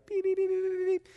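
high beeping radar noise. Retold or imitated. Pastor mimics his son's Hook radar with high beeps. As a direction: beep like a little radar alarm.

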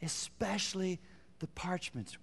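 quiet, voiced, slow "Especially the parchments"; soft repeat. Shown as a demonstration. Preacher repeating the key phrase to the congregation, softly. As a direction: say it again softly, aloud now. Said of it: "here's the phrase I want you to see".

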